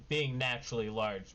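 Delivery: ordinary talk, nothing marked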